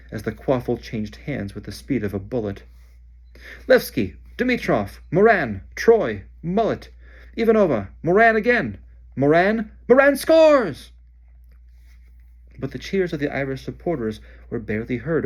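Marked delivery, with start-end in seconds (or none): 3.7-10.9 high, loud, racing sports commentary; excited commentary. Acted. Commentator calling the match to the crowd, building excitement. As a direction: call the goals like a frantic commentator.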